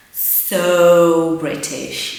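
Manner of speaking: so British dragged out slow and loud; drawn-out emphasis. Shown as a demonstration. Teacher stressing how British Jamie is. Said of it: "emphasising how British".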